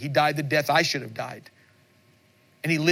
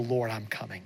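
soft humble reply; 4 semitones lower, 6 dB quieter than usual. Retold or imitated. Voicing a believer's soft reply.

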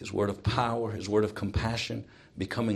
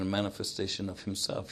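usual speaking voice

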